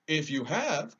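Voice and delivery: a kind metal man suggesting; lightly voiced tin woodman.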